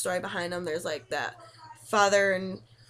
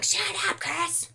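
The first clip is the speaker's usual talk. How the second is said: much higher, playful angry yell; mock angry yell; 10 semitones higher, slower than usual. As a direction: yell at the laughing boyfriend. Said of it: "Me going".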